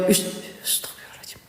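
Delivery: quick startled whisper. Real, not acted. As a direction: hiss the prayer out, spooked. Startled by noise at night.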